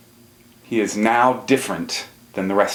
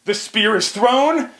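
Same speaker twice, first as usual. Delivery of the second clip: dramatic climax; 11 semitones higher, slower than usual. A narrator's reading. Climax of the acted hunt.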